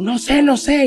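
high, rushed, scared denial; panicked answer. Retold or imitated. Narrator answering his girlfriend, high panicked reply. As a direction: blurt it out, voice cracking high. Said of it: "Y digo".

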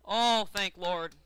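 long, loud sigh of relief in words; slow, booming thanks. Real, not acted. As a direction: draw it out loudly, hugely relieved. Relief at race going well.

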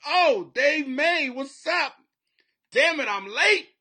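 high, drawn-out loud holler; loud greeting. Real, not acted. Host spots Dave May joining, loud greeting. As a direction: call out big to a newcomer. Calls out to arriving viewer.

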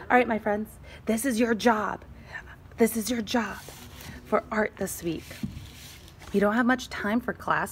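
plain reading voice

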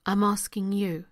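ordinary delivery

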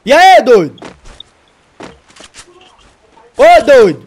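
loud, high call across. Real, not acted. Shouting to get the silent teammate to answer.

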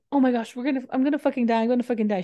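mock panic. Kidnapped girl to the operator, mock panic.

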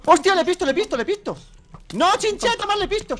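high-pitched excited cries; excited outburst. Real, not acted. Player spots something and warns Chincheto, excited. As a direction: blurt it out high, in a rush of alarm.

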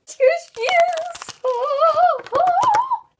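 very high, loud squeal; ecstatic squeal. Real, not acted. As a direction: shriek with delight at the toy. First sight of the toy.

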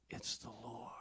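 hushed, soft whisper; awed whisper. Retold or imitated. Preacher voicing John whispering to Peter in the boat, hushed. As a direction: breathe it in awe. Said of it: "that guy leans down and says".

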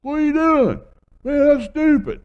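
high, loud yell of rebuke; mock human yelling. Shown as a demonstration. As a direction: holler it as an exasperated scolder. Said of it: "Humans yell".

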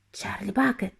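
soft, nervous half-whisper. A narrator's reading. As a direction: say your name timidly. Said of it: "нервовий шепіт". (нервовим шепотом)

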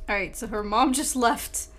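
ordinary talk

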